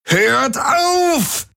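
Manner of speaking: high, sobbing scream, stretching auf; crying roar. A narrator's reading. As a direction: break down while screaming. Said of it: "brüllte Jun". (schluchzend schreien)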